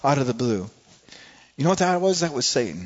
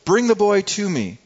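higher, louder command; firm command; 7 semitones higher, 5 dB louder than usual. Acted. Jesus commanding the disciples, firm and raised. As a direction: order it with frustrated authority. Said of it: "he's getting frustrated there".

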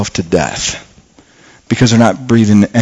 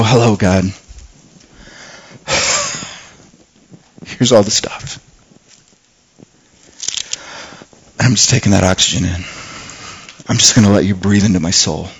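soft, hushed, lower prayerful voice; a believer pausing to pray, hushed and tender; quiet prayer; 4 semitones lower, 7 dB quieter than usual. Acted. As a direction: pray it tenderly, almost breathing it. Models a quiet prayer to God.

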